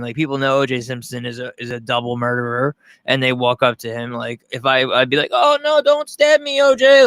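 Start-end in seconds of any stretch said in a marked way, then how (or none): 5.3-7.0 very high, louder pleading put-on voice. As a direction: beg O J in a mock maid voice.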